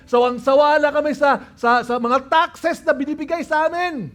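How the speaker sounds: high, impassioned outcry; fast, high-pitched protest; crowd crying out. Acted. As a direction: cry out as a fed-up crowd. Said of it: "They were crying out".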